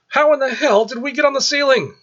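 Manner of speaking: high, loud frightened shout; panicked yell. Acted. Lisa clutching Michael on the ceiling, panicked high yell. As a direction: cry out in alarm, clinging on.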